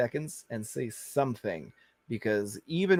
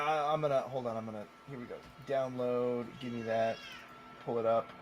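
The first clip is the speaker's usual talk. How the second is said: ordinary delivery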